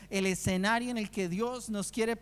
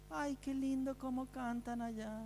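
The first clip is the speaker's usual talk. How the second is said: a hint of a charmed prisoner, hushed; soft admiring prisoner; 3 semitones higher, 8 dB quieter than usual. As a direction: murmur it like a moved inmate in the next cell. (preso conmovido, en voz baja)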